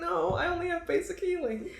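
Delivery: very high, hammed-up tearful voice; mock crying. Crying cleric answers about a res spell, mock tearful. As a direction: squeak it out through pretend sobs. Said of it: "While still crying".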